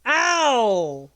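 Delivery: sharp loud cry of pain; pained yelp. Real, not acted. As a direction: yelp at a sudden hurt. Sudden pain off camera.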